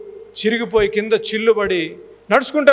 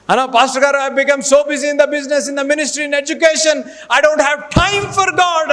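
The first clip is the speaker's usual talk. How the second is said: mock complaining believer; 8 semitones higher, 4 dB louder, slower than usual. Voicing a busy believer.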